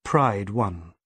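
ordinary delivery, nothing marked

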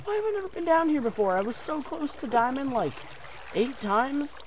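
very high disbelieving squeal; excited. Real, not acted. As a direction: shriek it in disbelief. Stunned at finding diamond.

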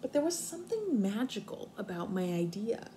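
expressive storybook reading for kindergartners; reading to children. Acted. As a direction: read it out like story time on the rug. Teacher reads picture book aloud.

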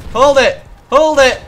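urgent shouted order. Real, not acted. Player telling partner to cook grenades, urgent shout. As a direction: call out urgently to hold on. Coaching grenade cooking under pressure.

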